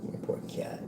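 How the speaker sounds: soft unvoiced whisper; whispered pity. Real, not acted. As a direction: breathe it out in pity for the cat. Pitying the crying cat aside.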